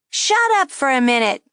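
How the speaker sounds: high, loud wisecracking puppet; a brash ventriloquist dummy needling; cheeky dummy voice. A narrator's reading. Dummy mocking the girl at the table, high and brash. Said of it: "the dummy said to the girl".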